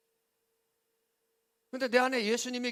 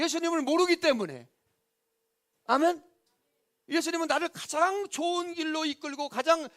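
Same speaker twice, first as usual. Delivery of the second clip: loud, high-pitched sermon delivery; full-force preaching; 6 semitones higher, 9 dB louder than usual. Real, not acted. Preacher explaining why believers resent Jesus, full-force preaching. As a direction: press the congregation hard with raised voice.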